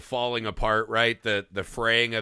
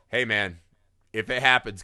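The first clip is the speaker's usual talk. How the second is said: normal talking voice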